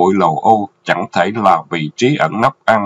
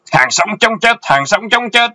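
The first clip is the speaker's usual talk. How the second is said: very high, shouted battle cry; enemy charge shout; 13 semitones higher than usual. A narrator's reading. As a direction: yell it like charging troops. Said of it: "tiếng hô xung phong dậy đất".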